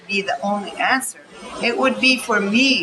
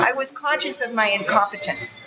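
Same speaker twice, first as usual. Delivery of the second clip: usual speaking voice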